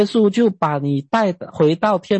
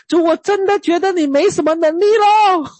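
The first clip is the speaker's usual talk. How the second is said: higher, sulky mock complaint, falling on 咯; pouting complaint; 10 semitones higher than usual. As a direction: sulk it out to God like a child. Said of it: "老实说，我真的跟神讲啊".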